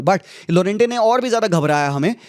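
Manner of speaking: ordinary talk, nothing marked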